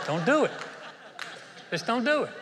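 loud, laughing warning; exhortation. Acted. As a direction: plead it high with a laugh. Preacher exhorting congregation.

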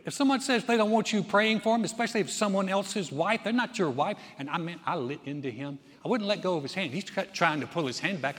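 normal talking voice

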